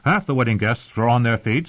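usual speaking voice

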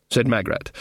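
plain reading voice

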